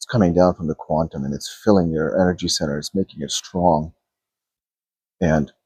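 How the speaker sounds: soft, low meditation voice; guided meditation. Acted. Coach guiding viewers to feel their field, hushed and calm. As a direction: talk them into calm, gently.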